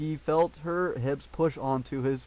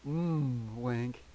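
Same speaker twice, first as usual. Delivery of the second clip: slow seductive. A narrator's reading. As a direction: draw the name out invitingly. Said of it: "slowly in a seductive voice".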